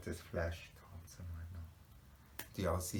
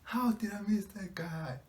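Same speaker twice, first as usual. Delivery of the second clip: high, loud disbelieving wail; loud, high-pitched burst of amazement; astonished outcry; 9 dB louder than usual. Real, not acted. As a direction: cry out at having missed it. Reacting to a missed detail.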